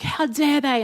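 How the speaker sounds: a retold flash of indignation at an email; worked-up outrage. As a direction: replay your own fuming disbelief. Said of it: "I was getting really worked up about it".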